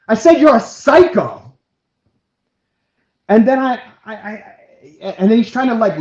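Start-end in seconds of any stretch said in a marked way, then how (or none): 0.1-1.5 loud, high retort; retold accusation. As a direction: hurl back the insult, re-enacting it. Retold insult in the quarrel.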